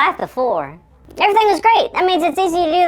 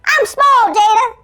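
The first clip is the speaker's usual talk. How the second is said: high squeaky shouting; cartoon elf voice; 6 semitones higher than usual. Tiny Carl telling Jada he shrank, high squeaky yell. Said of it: "sound like a cartoon elf".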